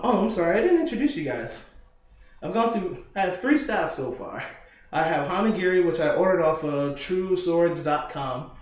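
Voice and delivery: ordinary delivery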